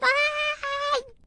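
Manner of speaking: long, high labour wail; drawn-out high cry of pain; scream of pain. Acted. Woman in labour crying out, pained.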